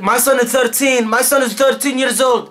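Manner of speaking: high, slow, emphatic mock-dad voice; insistent dad. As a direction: imitate dad stubbornly repeating himself. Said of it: "my dad comes out of nowhere. He's like".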